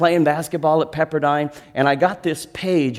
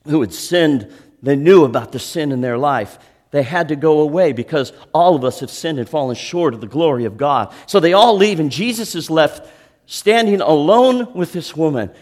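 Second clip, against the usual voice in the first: preaching at full force; 6 dB louder than usual. Acted. Pastor preaching the story at full force.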